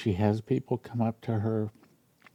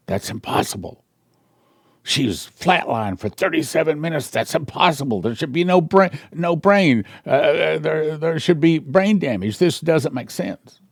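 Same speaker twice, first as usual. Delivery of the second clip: shocked doctor; 5 semitones higher, 3 dB louder than usual. Retold or imitated. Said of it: "whispering to a nurse".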